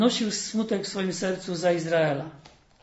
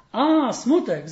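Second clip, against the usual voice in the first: a bright, joyful call, somewhat louder; joyful exclamation; 5 semitones higher, 6 dB louder, much slower than usual. A narrator's reading. Old woman happily recognising Sadness, joyful call. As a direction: greet Sadness like a dear old friend. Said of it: "zawołała staruszka z taką radością".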